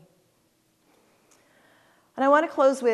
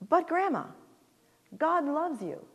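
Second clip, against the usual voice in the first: lightly voiced small child, a bit higher; little granddaughter; 6 semitones higher than usual. Toddler granddaughter comforting her crying grandma, lightly higher child voice. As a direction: gently play the little girl comforting grandma. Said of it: "my two-and-a-half-year-old granddaughter".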